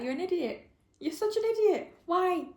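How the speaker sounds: high, pleading exasperation; exasperated scolding. Real, not acted. Reader scolding Lennon over a bad choice, exasperated. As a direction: wail it up high at someone who's let you down. Scolding a character while reading.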